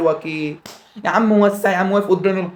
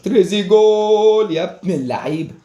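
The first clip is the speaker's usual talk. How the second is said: slow, stretched and louder goal cry; drawn-out louder goal celebration; commentator goal call; 4 semitones higher, 4 dB louder, slower than usual. Pretend. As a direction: draw out تريزيجول like a sportscaster.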